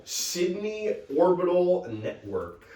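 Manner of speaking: slow, drawn-out grand announcement; mock-glamorous reveal, slow; drag name announcement. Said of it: "what is that, my frickin' drag name".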